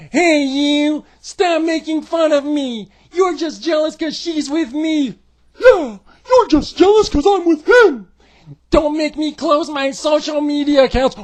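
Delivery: mocking a whiny couple. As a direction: whine it like a crybaby. Mocking Seth and Becky.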